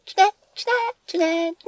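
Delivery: tiny squeaky mock curse, repeated over and over; hamster voice. Cursing the co-hosts playfully in a squeaky hamster voice. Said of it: "ハムスターボイスやから大丈夫です".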